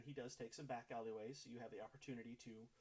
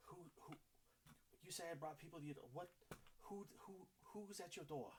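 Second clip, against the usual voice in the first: alarmed stammering; 6 semitones higher, 5 dB quieter than usual. Acted. Gustav alarmed, asks who came.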